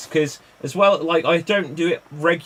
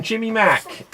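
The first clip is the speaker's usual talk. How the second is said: usual speaking voice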